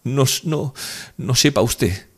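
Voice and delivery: high, broken-off tearful protest; distraught. A narrator's reading. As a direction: stumble over the words, upset. Distraught woman continues her outburst.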